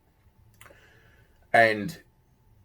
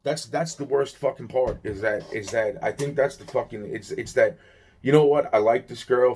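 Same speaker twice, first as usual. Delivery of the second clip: ordinary delivery, nothing marked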